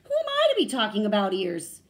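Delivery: high, very loud storybook dog; yippy dog. A narrator's reading. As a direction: exclaim it as an excitable dog. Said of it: "Dog yipped".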